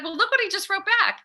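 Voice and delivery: high, fast indignant complaint; indignant mom. Retold or imitated. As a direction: show them, offended. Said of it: "And I was like".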